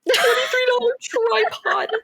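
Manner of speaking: high-pitched squealed mock protest; acted-out shrill-high no, stretched out; hammed-up refusal. Mock refusing her partner's offer, hammed up.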